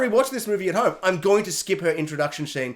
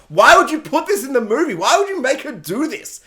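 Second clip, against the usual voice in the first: raised, high-pitched disbelief; exasperated rant; 6 semitones higher, 5 dB louder than usual. Real, not acted. Reviewer berating filmmakers over the character, exasperated. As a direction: demand an answer, exasperated.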